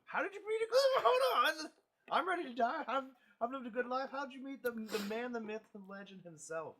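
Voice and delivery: high, loud, giddy shouting that gradually settles; over-the-top excited outburst easing down; mock fanboy freak-out. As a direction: lose it like a starstruck fan, laughing. Mock freak-out over Clint Eastwood story.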